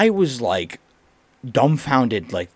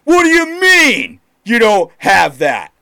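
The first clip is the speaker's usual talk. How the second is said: mock a furious customer, shouting; angry customer yell; 9 semitones higher, 7 dB louder than usual. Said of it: "So then he's like".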